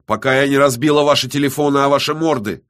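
loud, high, rapid threat; furious narrated outburst; angry threat. A narrator's reading. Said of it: "зло повторила Иола".

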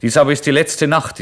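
landlady declaring loudly from the doorway. Acted. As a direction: announce it loud from the threshold. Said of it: "blieb auf der Schwelle stehen und sagte laut".

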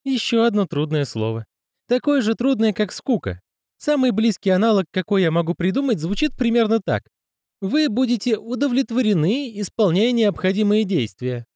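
high woman's voice; female character voice. Acted. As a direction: voice her high and patient. Miss Dobroserd voice.

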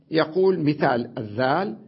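normal talking voice

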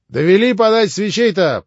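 loud, high, overfamiliar call for candles; ordering about like the master of the house; cheeky order. Acted. Rakitin, lounging in her parlour, bossily calling for candles. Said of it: "проговорил Ракитин с развязным видом".

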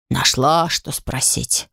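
slow, fading sigh. A narrator's reading. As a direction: sigh it out wearily. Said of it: "вздохнул фамильяр".

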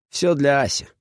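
ordinary delivery

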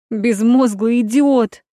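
high, loud furious shout; angry shout. Acted. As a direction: yell it at him in fury. Angry girlfriend scolding him.

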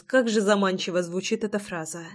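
usual speaking voice

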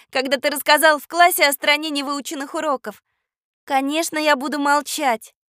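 high-pitched indignant rebuke. Acted. Katya reminds the boy he was teased, indignant. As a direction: scold him back in a high girl's voice. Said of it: "возмутилась Катя".

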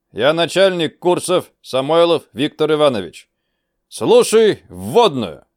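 loud, high officer's address; brief the troops with authority; commanding officer briefing. Acted. Chief briefs recruits.

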